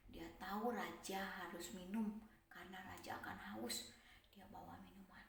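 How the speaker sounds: hushed narration; whisper. A narrator's reading. Storyteller whispering to the children, hushed.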